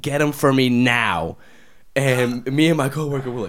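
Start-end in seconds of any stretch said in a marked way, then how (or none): 0.0-1.4 slow, louder imitation of a bossy kid; mock demand. Little girl ordering granddad around, mock bossy demand. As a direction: lay down a child's order, drawn out. Child demanding shoes, voiced.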